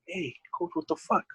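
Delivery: imitating an angry coach; mock angry challenge. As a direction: call out the other coach, half-acted. Opposing coaches quarrel retold.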